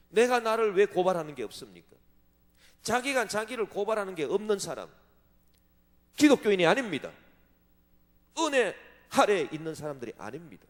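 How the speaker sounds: loud, driving pulpit proclamation; fiery preaching. Acted. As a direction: thunder it at the congregation. Preaching at full force.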